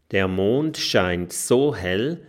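plain reading voice

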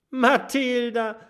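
hoch und laut vor Freude gerufen; freudestrahlend. Acted. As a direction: begeistert den Namen rufen. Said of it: "ruft Kokosnuss freudestrahlend". (Kokosnuss ruft Mathilda freudestrahlend zu)

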